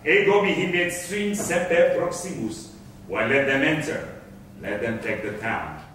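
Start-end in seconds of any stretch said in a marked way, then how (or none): 3.1-5.8 low, softer, trailing down; private aside. Acted. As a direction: drop the voice as if confiding to yourself. Barabbas alone, private aside.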